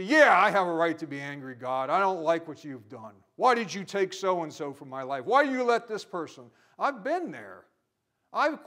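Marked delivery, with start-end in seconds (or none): 0.0-6.5 loud, bitter protest at God; angry complaint. Retold or imitated. A believer shaking a fist at God, loud and bitter. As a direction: shout the grievance upward. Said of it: "You say".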